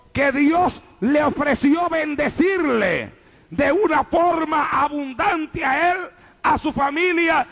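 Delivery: full-voiced, insistent pulpit storytelling; fervent preaching. Real, not acted. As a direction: preach it forcefully, driving each phrase to the congregation.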